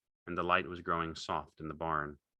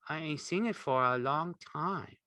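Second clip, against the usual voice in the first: higher, wistful simple man; wistful Lenny; 9 semitones higher than usual. A narrator's reading. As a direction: remember it sadly.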